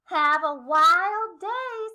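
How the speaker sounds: slow, loud cheery guide voice; zoo guide greeting. Acted. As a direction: draw it out like a zoo host. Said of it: "said the man at the front".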